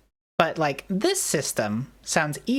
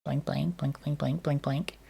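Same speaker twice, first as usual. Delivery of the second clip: soft blinking; 6 dB quieter than usual. Pretend. Mock blinking sounds.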